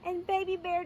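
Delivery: usual speaking voice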